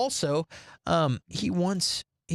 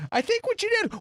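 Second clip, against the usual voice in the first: high-pitched imitation of a tearful wife; mock weepy woman's voice; crying wife; faster than usual. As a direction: play the wife, voice way up, no real sobs. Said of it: "she starts crying".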